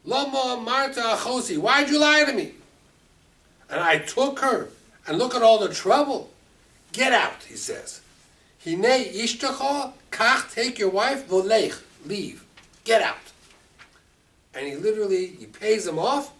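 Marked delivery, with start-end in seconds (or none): 0.1-7.4 loud, high, accusing shouts; furious Pharaoh. Acted. Pharaoh berates Avram, loud angry shouting. 8.6-13.2 loud, high dismissal; Pharaoh expelling. Acted. Pharaoh orders Avram out, loud and high.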